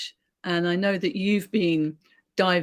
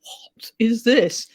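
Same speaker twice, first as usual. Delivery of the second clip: louder, higher mock disbelief; baffled son; 5 semitones higher, 5 dB louder, much slower than usual. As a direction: imitate a son puzzled by an odd book, drawn out slowly. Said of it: "he's like".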